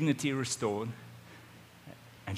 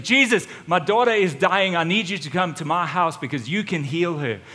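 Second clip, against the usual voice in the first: loud, urgent pleading of a desperate father; father pleading with Jesus, frantic and loud; frantic begging; 4 semitones higher, 8 dB louder than usual. Acted. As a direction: beg him like your child is dying. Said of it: "frantic and he falls at the feet of Jesus and he begs".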